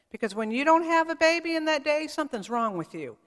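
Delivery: normal talking voice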